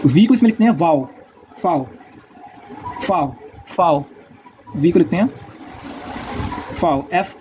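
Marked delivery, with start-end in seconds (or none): 3.0-4.0 "फाओ" said slowly with a clear f, like "fow"; drawn-out "फाओ", f then ow; German V name. Shown as a demonstration. Teacher saying the German letter V for students, slow and clear. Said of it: "ये बोलने में फाओ है".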